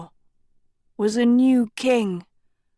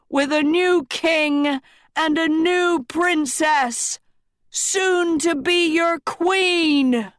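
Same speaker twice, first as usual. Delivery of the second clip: proclaiming king; 7 semitones higher than usual. A narrator's reading. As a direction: announce it to the whole square. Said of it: "Rian said".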